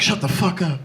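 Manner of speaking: soft low bedroom voice; low, soft and intimate. Retold or imitated. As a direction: murmur it low like a lover. Tender demand to partner.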